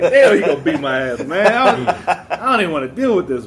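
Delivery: dad retells boyhood fear of a whupping, loud mock dread; loud, high comic panic; retold childhood dread. He recalls waiting for daddy, hammed-up dread. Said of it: "I just be sick".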